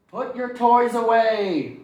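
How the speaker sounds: slow, high, loud order; firm cleanup command; stern mother ordering tidying; mom scolding. Acted.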